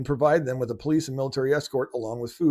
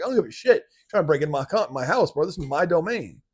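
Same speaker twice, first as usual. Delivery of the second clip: much higher, louder defiant yell; pretend furious homeowner; 7 semitones higher, 5 dB louder than usual. Homeowner yelling at a burglar to get out, furious. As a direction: roar him out of your house. Homeowner defending house against intruder.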